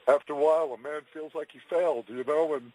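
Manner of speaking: fighting back tears, voice cracking; crying on the phone. Real, not acted. Caller describing job loss, near tears.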